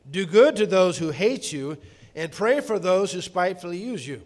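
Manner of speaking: loud, solemn Bible reading to the congregation; scripture reading. Acted. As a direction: proclaim the verses with weight. Reads Matthew five aloud.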